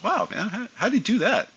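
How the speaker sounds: high-pitched retold amazement; amazed. Young pilot asking his best friend, amazed.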